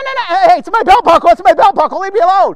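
hammed-up alarm at being searched; mock panicked protest. Employee to guard frisking him with wands, mock panicked.

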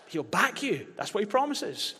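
ordinary delivery

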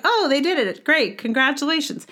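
mock dismissive. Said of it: "Not like".

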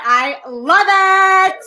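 put-on slow, high LA Valley Girl drawl; Valley Girl accent. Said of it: "in an L A accent".

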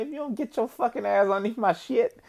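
high-pitched joking telling-off; mock indignant. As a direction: scold in a squeaky, put-on outrage. Acted scolding of commenting woman.

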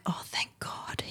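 hushed, breathy aside of relief; whispered relief. Retold or imitated. Mum recalling her relief at the play date, whispered. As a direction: whisper it like a secret thank-you.

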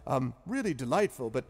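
put-on high, loud, rambling old scholar; chatty wizard. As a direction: wander off topic then get to it. Zephyros asking purpose.

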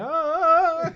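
high loud whimper; hammed-up high whine; high mock groan. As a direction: whine like the kid. Imitating the José video.

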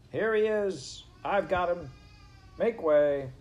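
loud, high-pitched announcement; calling out. Acted. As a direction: call triumphantly over a crowd. Furlow calling out through crowd.

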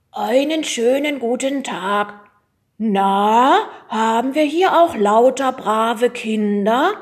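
slow, put-on pompous inspector; disguised school inspector voice. Boy disguised as school inspector greets teachers, put-on official voice. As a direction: play the grown-up official slowly and loudly. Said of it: "seine Stimme zu verstellen".

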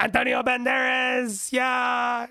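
high, drawn-out mock Dutch shout; weird Holland accent. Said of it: "They have, like, a weird fucking accent".

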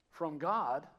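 normal talking voice

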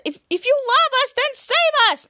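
high, loud acted plea; urgent hammed-up begging; desperate plea. Kids plead with the hero.

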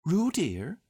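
reproachful. A narrator's reading. Kanga to Roo after an interruption, reproachful. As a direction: gently scold a child by name. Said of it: "said Kanga reproachfully".

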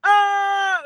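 high, loud wordless yell; a loud mock ah yell; imitated yell. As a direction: holler like a man tweaking. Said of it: "He goes".

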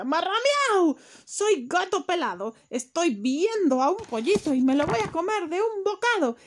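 high, loud cat meow, then threats; menacing cat. Acted. Cat looming over the pot, high and loud. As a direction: howl it right over the pot.

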